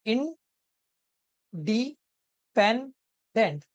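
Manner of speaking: "इंडिपेंडेंट" very slowly, long gaps between four syllables; broken into syllables. Shown as a demonstration. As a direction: say "इंडिपेंडेंट" as in... di... pen... dent. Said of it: "हम इनको तोड़-तोड़ के पढ़ते हैं". ("इंडिपेंडेंट" को तोड़-तोड़ कर, बहुत धीरे)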